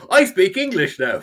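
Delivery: slow, high put-on English voice; mock English accent. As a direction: pretend to be English, joking. Joke after colonization talk.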